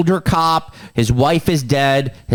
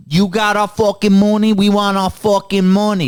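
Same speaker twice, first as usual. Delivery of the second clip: raised-pitch Boston tough demanding cash; Boston accent; 6 semitones higher than usual. Acted. Mercenaries demanding their money from a guy, Boston accent. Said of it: "that's my Boston accent".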